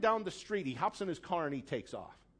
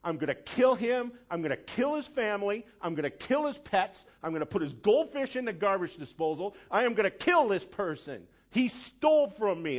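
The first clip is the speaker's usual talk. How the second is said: louder, higher furious ranting; retold furious outburst at the thief; furious threats; 4 semitones higher, 5 dB louder than usual. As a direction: rage at the thief who robbed him. Retelling his rage at the thief.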